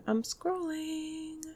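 silly voice. Pretend. Repeated in a silly voice.

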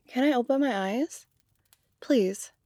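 normal talking voice

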